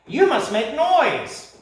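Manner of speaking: high, loud parrot shout. Acted. Parrot shouting at the creatures to make noise. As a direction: shout the order. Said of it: "he shouted".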